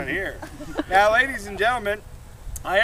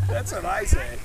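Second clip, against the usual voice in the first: ordinary delivery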